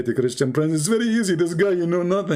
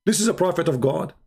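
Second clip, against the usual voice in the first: ordinary delivery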